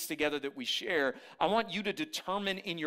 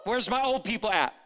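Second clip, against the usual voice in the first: loud hype call to the crowd; 4 semitones higher, 4 dB louder than usual. Real, not acted. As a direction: shout it to the room. Calling out to congregation.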